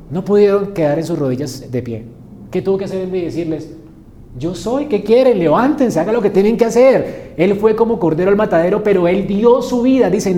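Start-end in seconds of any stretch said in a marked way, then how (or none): 4.4-10.4 loud, proclaiming sermon voice; forceful preaching. Acted. (predicación enérgica y en voz alta)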